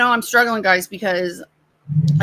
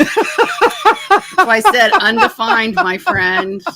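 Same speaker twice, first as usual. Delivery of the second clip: laughing; 7 semitones higher, 5 dB louder, much slower than usual. Real, not acted. Laughing at the Biden pi joke.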